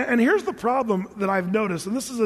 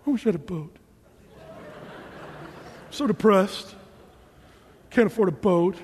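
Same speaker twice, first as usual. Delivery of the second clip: mock mopey sulk. Husband pouting to wife.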